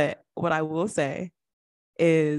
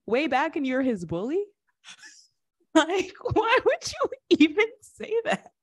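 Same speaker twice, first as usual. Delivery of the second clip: incredulous; 9 semitones higher than usual. Real, not acted. Incredulous rant.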